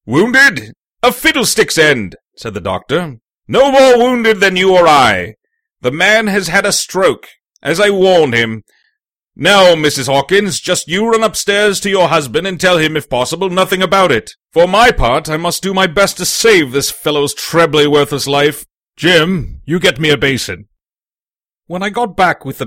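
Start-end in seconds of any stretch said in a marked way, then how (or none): 0.0-2.2 loud, high, dismissive doctor; brisk authority, raised and incredulous; scoffing doctor. Acted. Doctor scoffs at their alarm. 3.5-20.7 loud, commanding doctor voice; forceful, no-nonsense physician; brisk doctor, authoritative. Acted.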